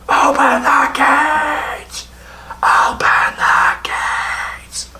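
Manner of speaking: high, slow desperate cry fading to a whisper; shut-out captain crying out; captain pleading. Acted. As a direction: beg from outside the wall. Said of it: "the captain is".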